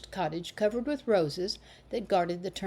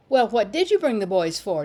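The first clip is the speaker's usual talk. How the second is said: louder, stern challenge; small girl demanding an answer from the boys; severe demand; 3 semitones higher, 7 dB louder than usual. A narrator's reading. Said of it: "demanded the small maiden severely".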